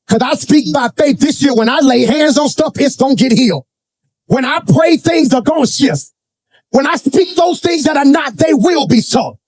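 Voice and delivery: rousing, high-pitched declaration of faith; declarative preaching. Real, not acted. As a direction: proclaim it to the congregation, pitched high. Preaches at full force.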